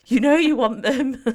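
laughing tease, high and loud. Real, not acted. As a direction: tempt her through a laugh. Teasing temptation.

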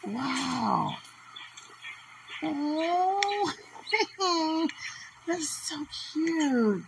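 high, loud delighted cooing; gushing over a cute video. Real, not acted. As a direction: squeal at how cute it is. Cooing over baby ape video.

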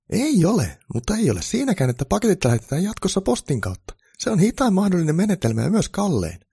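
high, loud keen elf voice; eager reformer elf. A narrator's reading. Elf pitching reforms to Santa, high and eager.

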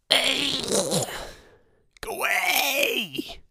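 high shrill-free mock shout, stretched "Go away"; mock yell. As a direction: shoo her off with a high yell. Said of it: "you turn around and go".

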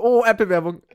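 high, loud delighted yell; excited shout. Retold or imitated. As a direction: scream it with joy.

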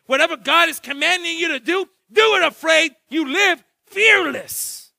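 loud, high preacher's call; full-force preaching. Acted. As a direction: preach it out to the whole church.

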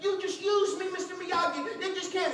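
high, acted angry protest; hammed-up indignant kid; mad. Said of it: "And he's mad".

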